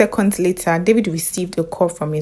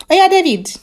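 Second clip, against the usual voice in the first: calling out; 9 semitones higher, 4 dB louder than usual. Acted. Host calling caller on air.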